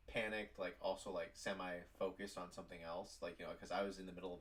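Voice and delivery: normal talking voice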